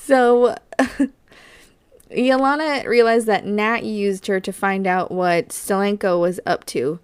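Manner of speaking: ordinary delivery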